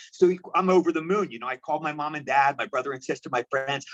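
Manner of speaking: usual speaking voice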